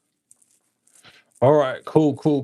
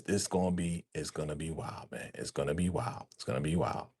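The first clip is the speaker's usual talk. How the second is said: ordinary talk, nothing marked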